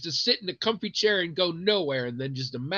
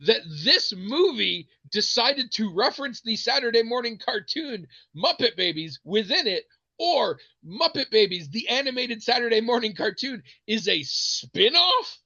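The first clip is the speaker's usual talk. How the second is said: pitched up, weighing each word; emphatic retold argument; 7 semitones higher than usual. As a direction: spell it out in mock exasperation.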